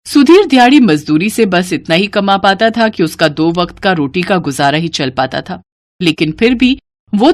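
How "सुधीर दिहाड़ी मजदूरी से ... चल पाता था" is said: ordinary delivery, nothing marked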